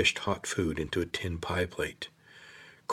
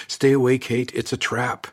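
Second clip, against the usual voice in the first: loud and higher, calling her back; urgent warning shout; 6 semitones higher, 6 dB louder than usual. Acted. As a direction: yell a warning before she walks into the trap. Corvin warns Kate of danger.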